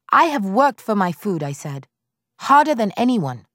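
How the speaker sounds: loud, high indignant retort; defiant outburst. A narrator's reading. Widow shouts back at her mother-in-law, defiant.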